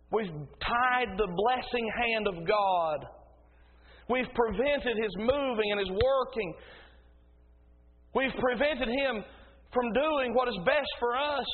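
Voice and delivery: punchy, ringing pulpit delivery; forceful preaching. Real, not acted. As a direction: drive each phrase home like a preacher.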